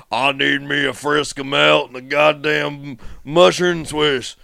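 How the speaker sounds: slow tough wrestler growl; Stone Cold Steve Austin. Retold or imitated. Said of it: "he sounded like Stone Cold Steve Austin".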